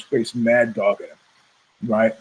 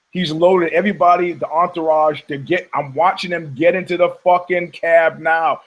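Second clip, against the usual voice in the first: ordinary delivery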